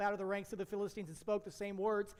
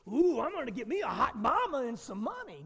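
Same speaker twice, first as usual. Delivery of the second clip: mock greedy soldier; 4 semitones higher, 6 dB louder than usual. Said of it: "because he goes".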